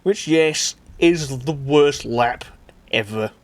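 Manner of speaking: ordinary delivery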